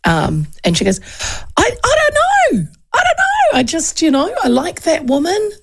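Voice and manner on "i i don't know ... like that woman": put-on high, light young woman's voice; young client voice. A hairdresser telling her client she likes that woman, high and breathy-light. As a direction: voice the girl, pitched way up.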